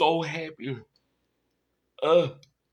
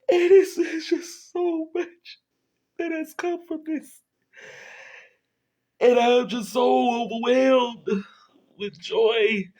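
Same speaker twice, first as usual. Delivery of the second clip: crying; 8 semitones higher than usual. Real, not acted. Overwhelmed host crying with gratitude.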